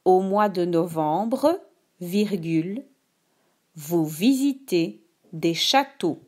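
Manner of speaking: slow, clear dictation voice; dictating. Shown as a demonstration. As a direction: read each word slowly for dictation. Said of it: "Écoute attentivement la dictée".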